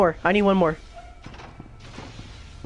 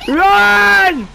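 loud high yell to flee; panicked shout; 9 semitones higher, 6 dB louder, much slower than usual. Real, not acted. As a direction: scream the warning. Monster chasing the players.

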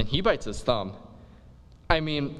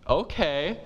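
breezy okay; 4 semitones higher than usual. Acted. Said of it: "she's like".

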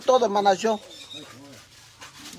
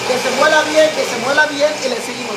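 loud over blender; 6 semitones higher, 7 dB louder than usual. Real, not acted. Talking over running blender.

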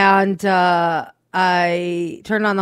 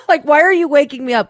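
ordinary talk, nothing marked

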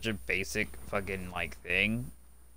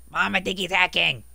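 put-on whiny kid; 8 semitones higher, 5 dB louder than usual. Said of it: "He's probably like".

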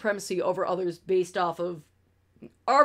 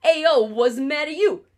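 put-on a touch of a New York accent, louder, tossed off; 4 semitones higher, 6 dB louder than usual. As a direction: throw it out like a Brooklyn guy on the corner. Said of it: "middle of yeehaw and".

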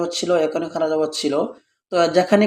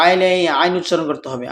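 ordinary talk, nothing marked

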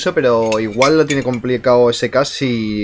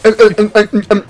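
glitching caster impression; 6 semitones higher, 3 dB louder than usual. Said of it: "le dio un spam y dijo".

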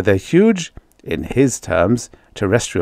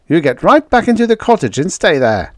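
raised, forceful command; barked order; 9 semitones higher, 5 dB louder than usual. A narrator's reading. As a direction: order them inside over the radio. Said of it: "the radio barked".

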